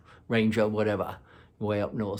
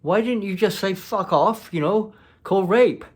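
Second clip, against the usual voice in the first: higher and louder, an indignant rant; exasperated scolding; 8 semitones higher, 5 dB louder than usual. Retold or imitated. Scolding the ex for letting the ranger turn on her, exasperated.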